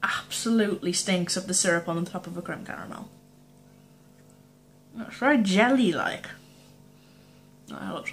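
talking while eating sweet; chewy food in mouth; mouth full, chewing. Real, not acted. Seen 0: Bites jelly sweet around 1s, talks with food in mouth.

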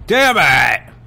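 loud angry outburst; frustrated yell. Real, not acted. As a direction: snap it out in frustration. Frustrated gamer yells.

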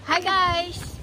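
ordinary delivery

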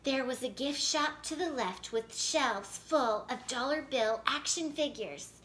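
plain reading voice

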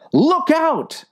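high, sharp yelled warning. Acted. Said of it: "yelled the man".

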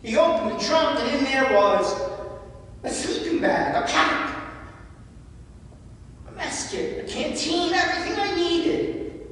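choked up, barely getting the words out; voice breaking. Real, not acted. Pastor recalling brother's gift to congregation, voice breaking. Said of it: "I get emotional every time I talk about this story".

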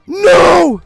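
loud, high-pitched "no" cry; anguished shout. Real, not acted. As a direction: scream it out as disaster strikes. Reacts as Pikmin get flung.

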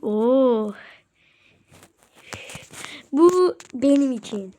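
ordinary delivery

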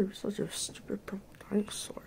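whispered insult; whispering. Real, not acted. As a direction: whisper it under your breath. Insulting the game quietly.

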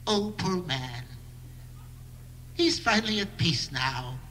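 soft, slow, hushed sympathy; quiet fake condolence; mock pity. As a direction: murmur it like a mourner at the bedside. Mock pity for thin man.

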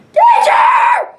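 very high, loud shout; shouted alarm. Acted. Game character shouting a danger alert, very high. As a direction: yell it like a siren.

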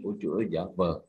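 ordinary talk

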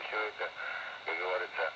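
ordinary delivery

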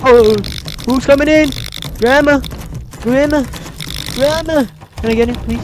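loud, high frightened calls; scared yelling. Real, not acted. Player yelling for grandma in the dark, frightened shouts. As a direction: shout for grandma in real panic.

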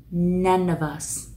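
"none of us" linked as "na-no-vus", slow. Shown as a demonstration. Teacher modelling the linked phrase for students. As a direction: stretch out "none of us" smoothly linked. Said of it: "đọc như thế nào".

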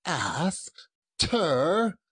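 high drawn-out cry. Acted. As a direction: wail it out at him. Scrooge's last dismissal.

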